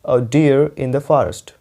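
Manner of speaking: slow, clearly spaced words; slow, careful teacher read; slow dictation. Shown as a demonstration. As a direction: read it out slowly for students to copy. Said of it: "ये देख रहे हो".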